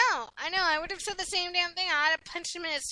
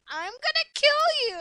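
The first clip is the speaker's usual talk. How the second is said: mock threat; 9 semitones higher than usual. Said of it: "he'd be like".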